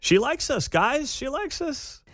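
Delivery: high-pitched mock gushing; mock delight. Mocks guest's boast about friends.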